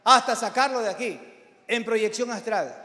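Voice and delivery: impassioned pulpit delivery; forceful preaching. Real, not acted. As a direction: drive it home to the congregation.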